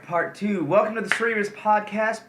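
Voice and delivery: big, high hyped announcer voice; announcer intro. Acted. Host opening the podcast for viewers, hyped announcer. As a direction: pump up the show opening like a host.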